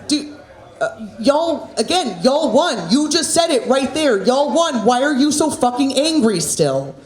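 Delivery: high, incredulous exasperation; exasperated retort. Acted. Comic answering angry online winners, exasperated and high-pitched.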